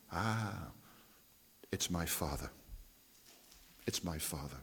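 very soft, hushed and reverent; softly confiding his Father sent him; hushed reverent reply. Acted. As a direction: drop almost to a murmur, tender certainty. Jesus answers softly in dialogue.

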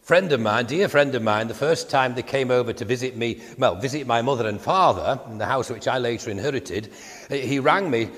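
usual speaking voice

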